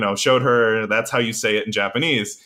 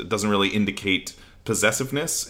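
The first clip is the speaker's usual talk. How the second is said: ordinary talk, nothing marked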